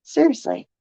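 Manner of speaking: usual speaking voice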